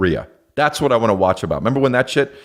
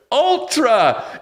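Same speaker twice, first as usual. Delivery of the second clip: mock announcer yell, much higher; hype shout; 13 semitones higher than usual. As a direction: belt the name like a festival hype man. Hyping the festival name.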